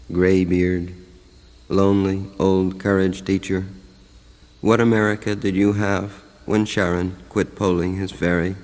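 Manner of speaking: ordinary talk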